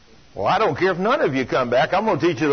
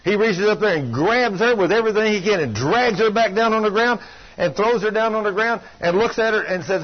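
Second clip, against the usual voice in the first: usual speaking voice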